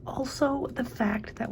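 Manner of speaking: hushed aside, barely voiced; secretive whispered aside. Real, not acted. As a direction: lean in and breathe the word. Sudden whisper while reacting to book.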